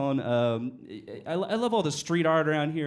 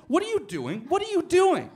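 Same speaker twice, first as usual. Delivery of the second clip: high, loud, rising confrontation; jealous lover yelling at the gym; outraged shout; 13 semitones higher, 6 dB louder than usual. Retold or imitated. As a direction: demand an answer, escalating. Confronting him at machine.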